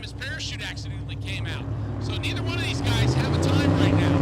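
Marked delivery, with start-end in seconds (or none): 2.0-4.2 loud announcer call. Real, not acted. Announcer calls run start.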